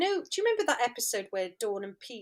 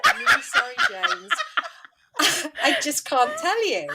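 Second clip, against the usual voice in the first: much higher, giggling through a teasing sorry; laughing apology; 6 semitones higher than usual. Retold or imitated. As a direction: tease him while laughing. Teasing James over secret script.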